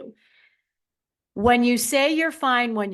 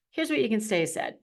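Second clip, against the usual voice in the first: normal talking voice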